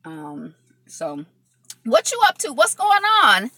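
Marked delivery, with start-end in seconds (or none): 1.8-3.5 excited greeting. Real, not acted. Greeting viewers in live chat.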